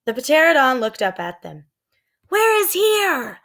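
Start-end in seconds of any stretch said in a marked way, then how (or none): 2.3-3.4 high slow called question; calling down. A narrator's reading. Annie calling down to ask the pterodon. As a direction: call it down to the ground. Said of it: "Annie called down".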